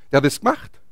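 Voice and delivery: ordinary talk, nothing marked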